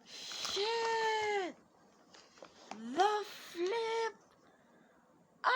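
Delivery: high squealing shrieks of joy; screaming excitement. Real, not acted. Unboxing a Canon camera on camera, squealing with joy. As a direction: shriek at the top of your range, overjoyed. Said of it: "I'm excited".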